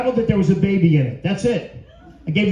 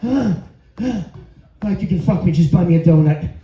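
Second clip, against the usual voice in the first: seductive woman voice; slower than usual. Acted. Voicing the heavy woman.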